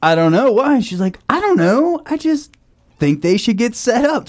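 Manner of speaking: high, light mom voice; imitating mom. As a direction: raise the voice to play mom. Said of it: "she goes".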